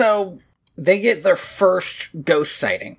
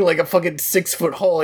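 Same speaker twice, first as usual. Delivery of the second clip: ordinary talk, nothing marked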